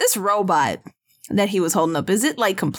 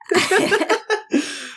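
laugh; 10 semitones higher than usual. Real, not acted. Laughs at cyborg compliment.

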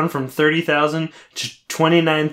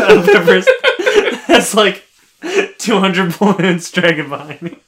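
laughing; 6 semitones higher, 6 dB louder than usual. Real, not acted. Laughing through the joke.

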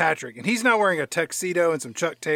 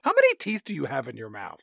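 normal talking voice

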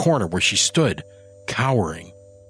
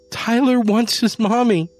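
high, louder desperate shout; 14 semitones higher, 4 dB louder than usual. A narrator's reading. Janie shouting at Adam for the kitten. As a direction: cry out for the child. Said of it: "she shouted".

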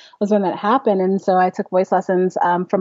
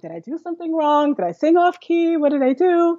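anxious inner voice; 8 semitones higher than usual. Retold or imitated. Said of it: "I'm like".

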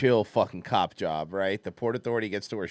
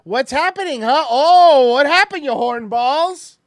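teasing shout; 13 semitones higher, 11 dB louder than usual. Real, not acted. Teasing call at the screen.

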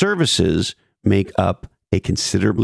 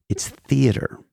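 breathy stage whisper; 5 semitones higher, slower than usual. Acted. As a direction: whisper it like a secret. Dramatic whispered punchline.

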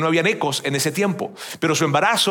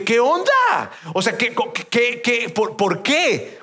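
higher-pitched, stammering disbelief; indignant protest to God; 5 semitones higher, much slower than usual. Retold or imitated. Rejected job candidate demanding answers from God, indignant. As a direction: demand an explanation, stumbling over the words. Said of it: "yo me enojé con Dios".

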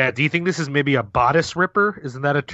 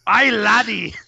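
short, higher-pitched attempt at a Scottish accent; 7 semitones higher, 3 dB louder, slower than usual. Shown as a demonstration. Podcast guest attempts a Scottish blockbuster line on request, Scottish accent. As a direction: put on a hammy Scottish call. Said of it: "do a Scottish accent".

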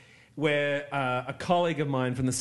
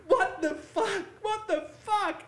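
high, panicked mock outrage; shocked girl; slower than usual. Model whose ear was cut, high panicked disbelief. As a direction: cry out in disbelief. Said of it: "the girl goes".

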